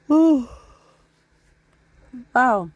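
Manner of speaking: ordinary talk, nothing marked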